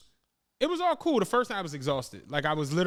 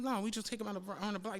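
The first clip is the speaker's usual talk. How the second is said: soft, offhand persuasion; casual coaxing; 2 semitones higher, 9 dB quieter, faster than usual. Retold or imitated. Friend pitching a drive round the block, soft casual coaxing. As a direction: play down the risk in a softened voice.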